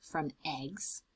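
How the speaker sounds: usual speaking voice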